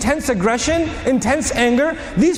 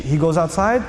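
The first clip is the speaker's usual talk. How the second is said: ordinary talk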